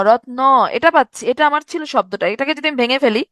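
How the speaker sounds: usual speaking voice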